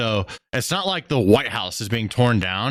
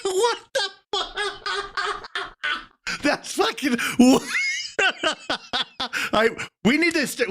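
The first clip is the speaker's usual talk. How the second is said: cracking up. Real, not acted. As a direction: lose it laughing mid-sentence. Cracking up at clip.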